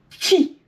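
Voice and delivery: ti said high and loud, the t hard and doubled; a hard, ringing ti for a child to copy; emphatic ti. Shown as a demonstration. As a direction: hit the ti hard so the doubled t is heard. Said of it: "pour vraiment l'entendre".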